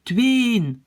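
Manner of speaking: "tweeën" as one long syllable, no break; wrong run-together pronunciation. Shown as a demonstration. Teacher warns learners of a mistake, "tweeën" wrongly in one syllable. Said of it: "on risque de dire".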